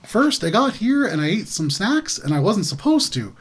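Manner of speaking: lively sing-song swoops in pitch; childlike in-character voice. Acted. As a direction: innocent character proudly reporting the day. Player voices Glub character.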